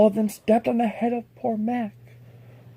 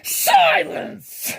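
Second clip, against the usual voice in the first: much higher, loud bark of a word; barked command; 4 dB louder than usual. Acted. The king silences Mac from on top, sharp bark. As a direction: cut him off like a tyrant. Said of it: "barked back".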